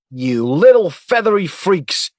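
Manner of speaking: loud, high, slowed shout upward; shouting at the sky. Acted. Agent yelling at the gulls overhead, furious shout. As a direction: yell up at the birds, furious. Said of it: "he shouted at the sky".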